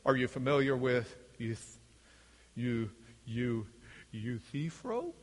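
low, quiet, halting question; Oxford philosopher. Acted. Oxford philosopher testing a student, low and halting. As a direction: probe softly in a low voice, stumbling on the name.

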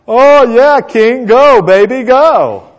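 high, loud, stretched mock encouragement; sarcastic cheering. Micaiah tells the king to go to battle, sarcastic. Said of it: "we know it's sarcastic".

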